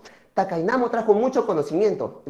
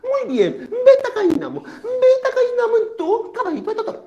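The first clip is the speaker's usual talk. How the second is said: very high deity voice; high, theatrical send-off; moon sending off; 13 semitones higher, 4 dB louder than usual. Acted. Moon sends Takainamo to sea, high put-on voice.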